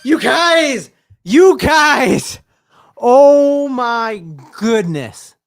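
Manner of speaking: real high, loud yell of amazement; thrilled, high-pitched fan scream; ecstatic shouting. Real, not acted. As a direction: lose it over a spectacular touchdown. Reacting to spectacular touchdown live.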